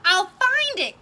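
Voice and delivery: loud, high vow with FIND punched; Horton's loud determined cry. Acted. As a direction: cry out that you won't give up. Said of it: "cried Horton".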